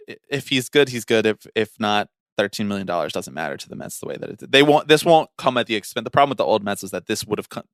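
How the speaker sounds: ordinary talk, nothing marked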